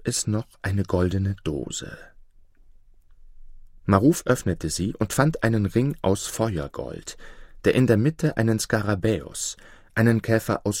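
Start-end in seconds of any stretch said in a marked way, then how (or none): none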